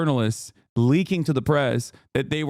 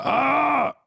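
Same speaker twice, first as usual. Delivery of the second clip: mock yell; 12 semitones higher than usual. Mock rage, reading taunts.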